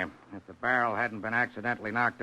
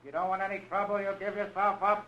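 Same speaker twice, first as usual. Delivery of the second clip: higher call, heard through a door; knock and announce; 8 semitones higher than usual. Acted. Police calling through door.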